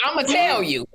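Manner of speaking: high playful mimic; mocking friend. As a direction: tease her eagerness to spill. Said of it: "And so you go".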